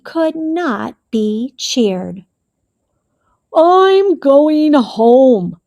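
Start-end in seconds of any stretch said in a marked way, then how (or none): 3.5-5.6 slow, louder, grave bear voice; solemn Bear announcement. A narrator's reading. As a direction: announce it like a gloomy big bear. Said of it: "Bear announced".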